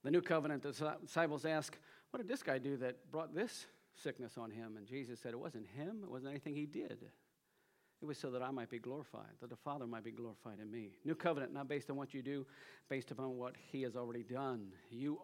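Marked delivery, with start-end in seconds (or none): 2.1-10.9 low, very soft quoted dialogue; hushed retelling. Retold or imitated. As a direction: bring the exchange right down in volume. Preacher retells disciples questioning Jesus quietly.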